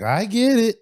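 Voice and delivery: high, loud, irritated comeback; snippy attitude. Retold or imitated. Girlfriend answering a reminder about the package, snippy. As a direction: snap it back with attitude. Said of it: "The attitude, the attitude is everything".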